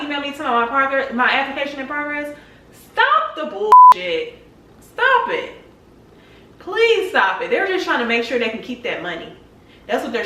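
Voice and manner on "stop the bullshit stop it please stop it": high-pitched, drawn-out angry demand; exasperated outburst. Real, not acted. Fed-up traveler to the passport agency, exasperated. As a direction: plead sharply for them to stop, pitch way up.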